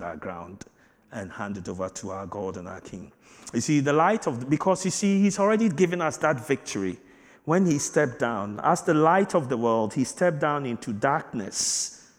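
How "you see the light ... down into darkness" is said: loud, rising sermon delivery; full-force preaching. Acted. Pastor preaching loudly to the congregation about strength. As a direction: preach it out to the church.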